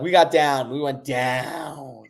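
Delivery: drawn-out, pained "down". Real, not acted. Trader reliving the price drop, pained. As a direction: let the word down drag out in pain. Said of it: "I can hear the pain in your voice".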